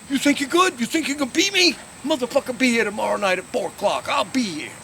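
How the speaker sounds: high-pitched shouted mock rant; irate challenge. Tennis player challenges the man who laughed before storming off, irate. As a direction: throw down a challenge, loud and high.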